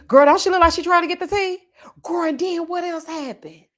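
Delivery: high, loud, delighted gossip about the tea; excited gossip. Real, not acted. To the chat about Sam seeking tea, excited high gossip.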